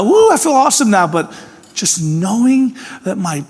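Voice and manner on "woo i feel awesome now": high, loud mock whoop; hammed-up feel-good exclamation; mock elation. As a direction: toss off a fake cheer.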